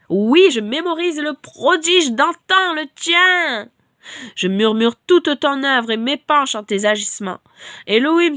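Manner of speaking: loud, declaiming scripture reading; fervent psalm reading. Acted. A preacher reading Psalm 77 to listeners, fervent declaiming. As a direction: proclaim the psalm like a lament.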